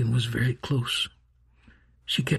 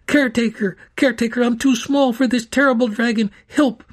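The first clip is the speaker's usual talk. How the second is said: high, loud, fast panicked call; frantic plea; 9 semitones higher, 5 dB louder than usual. A narrator's reading. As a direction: beg for rescue in a rush. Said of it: "she cried".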